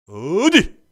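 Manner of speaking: high, loud shout; sudden shout. Pretend. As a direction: blast the word like an exorcist. Demon-chasing exclamation. (귀신 쫓듯 높이 외치는 소리)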